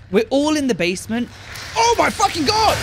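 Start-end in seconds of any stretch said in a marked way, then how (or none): 1.8-2.8 shrieks high and loud in fright; panicked scream. Real, not acted. Jump scare in horror game.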